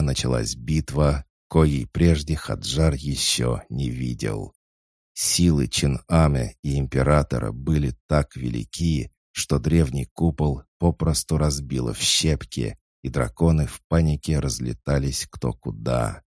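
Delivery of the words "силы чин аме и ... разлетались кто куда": ordinary delivery, nothing marked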